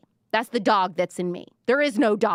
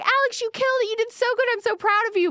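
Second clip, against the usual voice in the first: high, gushing mom voice full of pride; doting mom; 3 dB louder, faster than usual. Retold or imitated. Fans as moms praising their child, in a high gushing voice. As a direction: cheer her on like an adoring mother.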